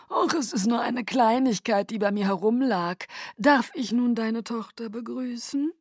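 courtly Aro. Acted. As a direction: flatter her with lofty charm. Aro's voice.

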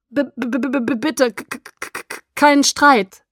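stuttering from cold, pitch jumping high; teeth chattering stutter. A narrator's reading. Freezing Bella begging the two not to fight, chattering stutter. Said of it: "Ich klapperte so heftig mit den Zähnen".

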